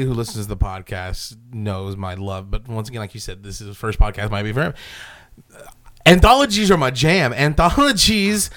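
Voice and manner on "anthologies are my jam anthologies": enthusiastic. Real, not acted. Enthusing about anthologies.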